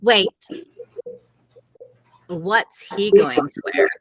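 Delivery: usual speaking voice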